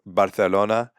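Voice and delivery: "Barcelona" with TH for the C; Castilian lisp. Shown as a demonstration. Giving a city as example, Castilian lisp. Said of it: "they use a lisp on words with Z's and C's".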